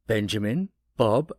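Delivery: ordinary delivery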